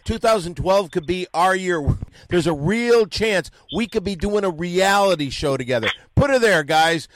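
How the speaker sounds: rally everyone for midnight; excited countdown hype. Real, not acted. Host rallies the guys as midnight nears, excited and loud.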